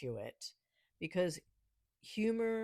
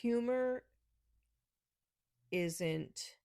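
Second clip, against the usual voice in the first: ordinary talk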